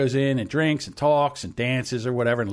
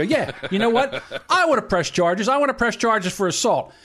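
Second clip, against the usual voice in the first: higher, worked-up insistence; indignant retold demand; 5 semitones higher than usual. Man with ripped jacket answers the police, indignant. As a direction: re-enact an aggrieved complaint.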